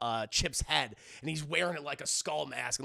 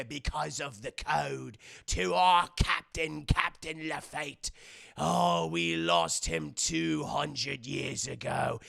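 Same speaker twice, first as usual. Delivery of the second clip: slow, low reverent pirate voice; hammy, grave pirate elder; solemn pirate rat; 4 semitones lower, slower than usual. Retold or imitated. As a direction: tell the sacred legend of a lost captain. Pirate rat recounts lost captain's legend.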